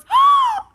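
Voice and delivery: loud, high, amazed gasp; child's big gasp. Retold or imitated. Aunt acts out her niece gasping loudly at the rainbow. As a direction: gasp in wonder like a little girl. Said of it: "Like that, right? I mean, like, pumped".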